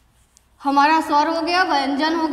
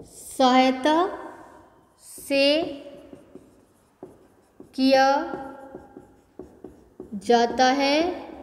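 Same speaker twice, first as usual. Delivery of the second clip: slow dictation; much slower than usual. Shown as a demonstration. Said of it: "इसका उच्चारण".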